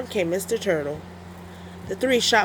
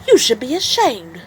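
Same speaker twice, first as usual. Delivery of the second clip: higher, louder scolding pig voice; scolding Missus Pig; 5 semitones higher, 7 dB louder than usual. Acted. Missus Pig scolds the wolf, high scolding voice. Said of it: "said Missus Pig".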